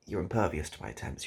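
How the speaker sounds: ordinary delivery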